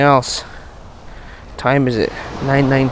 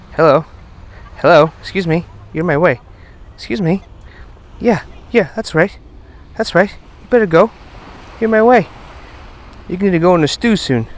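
playful shooing; 6 semitones higher than usual. Real, not acted. Man shoos his friend Mister Crab off, playfully bossy. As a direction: boss the little crab out of your path, half joking.

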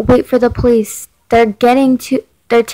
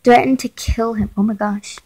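ordinary delivery

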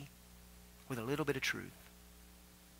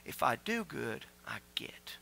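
hushed mock; 4 semitones lower, 6 dB quieter, slower than usual. Mocking old covenant thinking.

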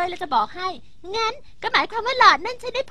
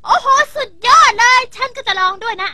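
excited; 5 semitones higher, 8 dB louder than usual. Acted. Excited at the wish straw.

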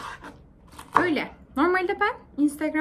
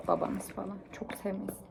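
hushed conspiratorial whisper; 5 semitones lower, 12 dB quieter than usual. Real, not acted. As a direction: lean in and whisper it. Conspiratorial aside to viewers. (fısıltıyla)

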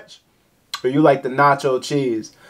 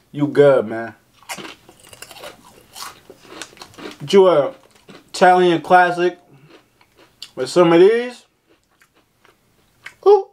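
chewing while talking; speaking around food; mouth full; 2 semitones higher than usual. Real, not acted. Seen 1: Chip eaten just before; jaw and lips move as if chewing during speech.

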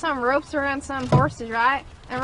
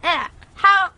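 put-on country accent; 3 semitones higher than usual. Friend greets Cassidy in a pretend country accent. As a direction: do a playful country accent, a laugh breaking in. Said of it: "I'm also gonna do a country accent".

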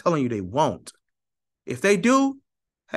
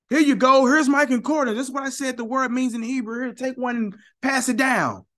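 cheery book handout; 11 semitones higher than usual. Retold or imitated. Voicing James Strong handing out books.

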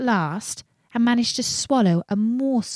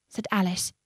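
ordinary delivery

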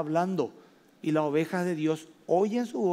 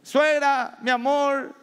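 weeping confession; 5 semitones higher, 3 dB louder than usual. Retold or imitated. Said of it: "Venía un hombre llorando y decía".

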